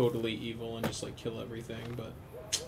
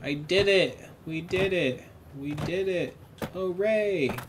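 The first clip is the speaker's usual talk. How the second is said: mock victory cheer; 6 semitones higher, slower than usual. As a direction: celebrate like a cartoon hero winning. Cheering after a win.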